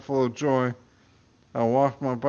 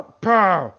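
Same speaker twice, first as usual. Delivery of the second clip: gunshot pow; 6 semitones higher, 7 dB louder than usual. Acted. Gunshot imitated.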